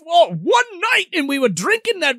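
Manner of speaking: high, protesting put-on voice; mock indignant denial. Bickering couple denies being an item, mock indignant. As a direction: protest it high, hammed-up and flustered.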